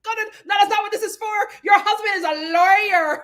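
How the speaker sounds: a lightly voiced exasperated Alexia; imitating Alexia. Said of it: "And Alexia was like".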